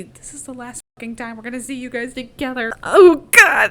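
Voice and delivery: mock tearful farewell rising to a loud wail; mock emotional. Prep team seeing Katniss and Peeta for the last time, mock emotional. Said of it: "getting really emotional because they see".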